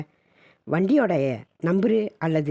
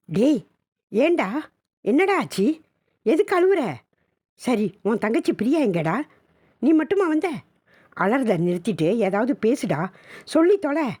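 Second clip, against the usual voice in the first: frantic scolding; 6 semitones higher than usual. Acted. Mother demands why son cries.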